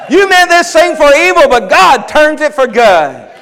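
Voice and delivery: loud, high rebuke to the devil; defiant shout. Acted. Preacher rebuking the devil, a defiant shout.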